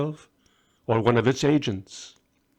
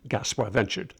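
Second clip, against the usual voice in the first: plain reading voice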